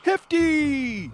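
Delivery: high, loud joyous cheer. Acted. The crowd of Smurfs cheering as Hefty arrives. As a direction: cheer the hero's name. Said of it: "cheered the crowd".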